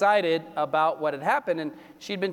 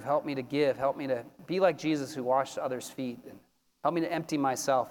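prayer; 4 semitones lower than usual. Acted. Pastor leads communion prayer.

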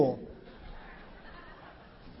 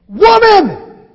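loud, high call of Woman; exclamation; 10 semitones higher, 13 dB louder than usual. Acted. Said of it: "answered with an exclamation mark".

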